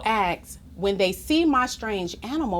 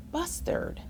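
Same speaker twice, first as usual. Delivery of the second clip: whisper; 6 semitones higher, 10 dB quieter than usual. Acted. Whispered name in story.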